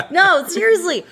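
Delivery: amused laugh while insisting. Real, not acted. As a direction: giggle through the words. Joking about fans, laughing.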